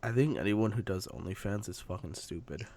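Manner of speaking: ordinary delivery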